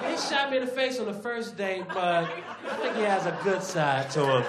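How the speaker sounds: hint of a hopeful girlfriend. Voicing the game girlfriend.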